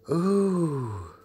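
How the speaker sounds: high, long drawn-out "ooh"; acted exclamation of surprise; startled ooh. A narrator's reading. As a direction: let out a high, falling ooh. Lucy exclaims in the story.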